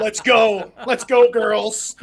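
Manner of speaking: loud, drawn-out mock hype call; drive-through mic voice. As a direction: call it out, stretching the last words. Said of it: "have the little drive-through one".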